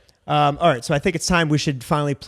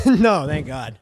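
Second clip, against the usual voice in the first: laughing; 5 dB louder, much slower than usual. Real, not acted. Laughing answer about penetration.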